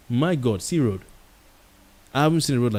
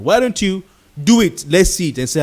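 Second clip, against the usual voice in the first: rising, louder demand; exasperated challenge; 6 semitones higher, 5 dB louder than usual. Real, not acted. Challenging absent officials, exasperated and loud. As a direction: call them out, pushing up loud.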